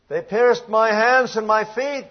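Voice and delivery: lifted, drawn-out lament; anguished cry. Acted. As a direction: read it as a cry, slow and high. Dramatic reading of psalm cry.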